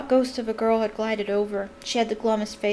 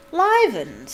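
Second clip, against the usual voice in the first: loud, higher mock-startled cry; fake astonishment; 4 semitones higher, 6 dB louder than usual. A narrator's reading. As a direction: overplay being surprised. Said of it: "giving a huge fake jump".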